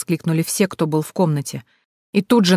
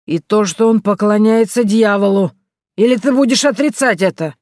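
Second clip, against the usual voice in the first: loud, higher challenging accusation; taunting drunk old man; 4 semitones higher, 5 dB louder than usual. Acted. (громко, с вызовом)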